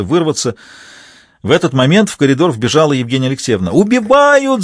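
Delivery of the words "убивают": high, loud panicked scream; screaming for help. A narrator's reading. Wife screaming to the whole house, panicked loud scream. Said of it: "закричала она на весь дом".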